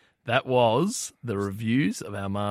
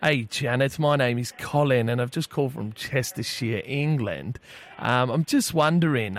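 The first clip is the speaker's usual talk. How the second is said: badly faked Englishman on the phone; poor English accent. Prank caller Colin asks cafe about tea, poor English accent. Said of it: "disregard the piss poor English accent".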